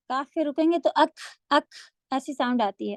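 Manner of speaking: normal talking voice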